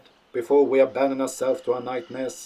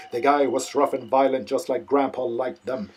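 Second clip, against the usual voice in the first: usual speaking voice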